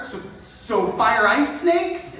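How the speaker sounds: ordinary delivery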